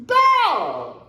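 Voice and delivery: usual speaking voice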